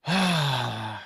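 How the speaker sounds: low, drawn-out exhale; heavy sigh. Real, not acted. Fan trying to calm down, heavy low sigh. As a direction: breathe out long to steady yourself.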